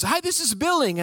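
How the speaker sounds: a chirpy, sing-song billing department; billing rep. Retold or imitated. Billing rep answering the wrong call, mock chirpy. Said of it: "more impressions of DirecTV people".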